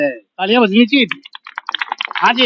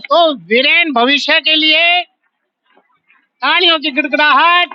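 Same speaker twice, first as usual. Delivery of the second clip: higher, slow, drawn-out announcer call; announcer calling applause; 4 semitones higher than usual. Real, not acted. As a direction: stretch it out to rouse the crowd. Host calls for thunderous applause.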